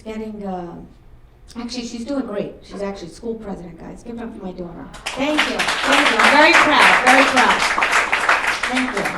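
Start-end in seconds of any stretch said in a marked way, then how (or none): 5.1-9.0 loud thanks over applause; loud proud thanks. Real, not acted. Mother thanking the audience clapping for her daughter, loud proud thanks. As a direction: call it out to a clapping crowd.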